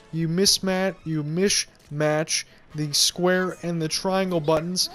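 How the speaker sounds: ordinary talk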